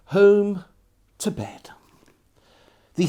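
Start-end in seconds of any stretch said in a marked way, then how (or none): 1.2-1.7 whisper. A narrator's reading. Diary entry closing at bedtime.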